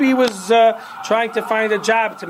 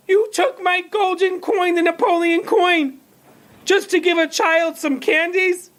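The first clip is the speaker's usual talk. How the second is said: much higher, outraged mother shouting thief; hammed-up shrieking at the storekeeper; high-pitched furious mother yelling at the shopkeeper; mock screaming mother; 11 semitones higher than usual. A narrator's reading. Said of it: "She was screaming".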